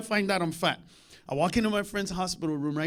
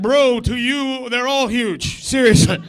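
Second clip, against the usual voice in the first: dismissive; 3 semitones higher, 9 dB louder, slower than usual. Retold or imitated. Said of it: "You'd be like".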